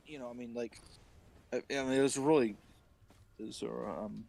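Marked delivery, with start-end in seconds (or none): none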